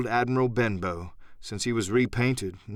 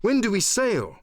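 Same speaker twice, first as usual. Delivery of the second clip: put-on higher, eager boy's cry; 8 semitones higher than usual. A narrator's reading. Jim eagerly asks squire about sailing, excited cry. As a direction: burst out with excitement. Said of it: "cried I".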